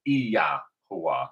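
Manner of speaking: "Yahuwah" opened out as ee-YAH, hoo-ah. Shown as a demonstration. Teacher explains the Y, "Yahuwah" as ee-YAH-hoo-ah. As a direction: stretch the Y of "Yahuwah" into a clear ee-yah. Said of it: "that Y is actually".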